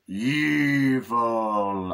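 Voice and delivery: long, stretched-out mock wail; drawn-out cry. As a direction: drag the word right out in a put-on voice.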